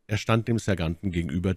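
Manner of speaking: usual speaking voice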